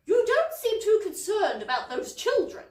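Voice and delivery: a fussy, indignant lady, pitched high. Acted. Miss Polwarth warning Cauldron, prim and high. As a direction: read her like a proper busybody.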